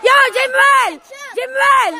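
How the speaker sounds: insistent sideline shout; real, urgent name-calling. Real, not acted. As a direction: yell to get a player's attention.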